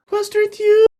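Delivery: put-on high, pleading little girl voice; puppy-dog eyes in the voice; little girl Elba. Little girl looking up at the wizard, high and pleading. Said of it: "as she looks up".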